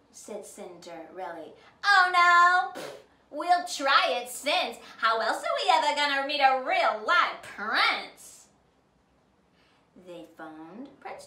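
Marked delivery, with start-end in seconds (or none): 1.8-8.5 loud, higher, brash and scheming; pushy stepsisters. A narrator's reading. Stepsisters scheming to meet the prince, brash and loud. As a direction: blurt it out greedily. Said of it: "said Sue and Nellie".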